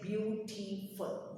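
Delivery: "beautiful" sounded out slowly in three beats; beau-ti-ful, each part of "beautiful" separate; teacher demonstrating the syllables in "beautiful". Shown as a demonstration. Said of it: "number of times you bring your chin down".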